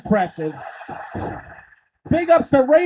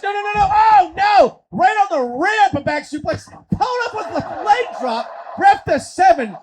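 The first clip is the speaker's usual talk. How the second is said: raised, alarmed wrestling call; high-pitched ringside excitement; excited play-by-play; 8 semitones higher, 3 dB louder than usual. Acted. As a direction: call a shocking slam as it happens.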